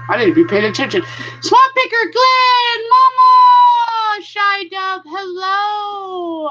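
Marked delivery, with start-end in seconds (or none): 1.4-6.5 high, bright calling out of names; excited greeting. Real, not acted. Streamer calls out to arriving chat viewers, excited and high. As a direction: sing out each name like waving hello.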